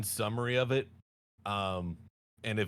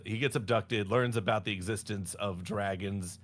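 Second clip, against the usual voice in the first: ordinary talk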